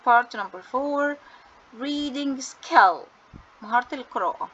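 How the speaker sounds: each English word drawn out very slowly; slow heading read. Shown as a demonstration. Teacher announcing the next section to students, very slow and deliberate. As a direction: spell out the title word by word.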